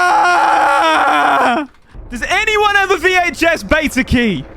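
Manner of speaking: high loud hammed-up yell; exaggerated outburst for show; mock angry shout. Streamer faking rage for content, loud high yell. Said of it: "I'm not actually that angry".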